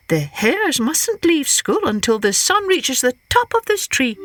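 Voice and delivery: high, innocent trickster hare; high sly Hare voice. Acted. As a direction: play Hare sweet and cunning.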